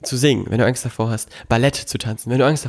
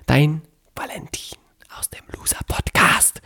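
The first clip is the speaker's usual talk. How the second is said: whispered sign-off; whispering; 3 semitones higher than usual. Acted.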